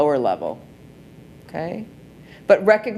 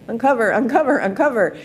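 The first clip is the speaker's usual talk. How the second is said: calling out; 6 semitones higher, faster than usual. Retold or imitated. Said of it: "I'm like".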